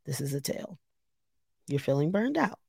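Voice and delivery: soft murmured note to herself; quiet self-talk. Retold or imitated. Speaking softly to herself about burnout. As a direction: quietly tell yourself the truth. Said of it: "I literally said out loud to myself".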